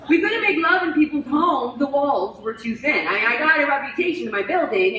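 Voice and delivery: exclaimed comic complaint. Acted. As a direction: belt out the grievance to the audience. Comic complaint about being too loud.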